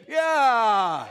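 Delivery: loud, drawn-out whoop of approval; triumphant cheer. Real, not acted. Pastor cheering the church's recitation, triumphant shout. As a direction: cheer the group on at full voice.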